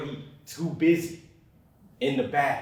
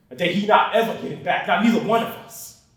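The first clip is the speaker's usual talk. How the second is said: higher and louder in pain; anguished rise; 6 semitones higher, 7 dB louder than usual. Acted. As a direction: push it out with regret. Anguish over lost birthday.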